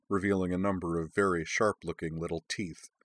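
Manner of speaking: ordinary delivery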